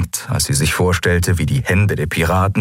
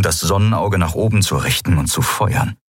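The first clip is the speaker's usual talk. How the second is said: plain reading voice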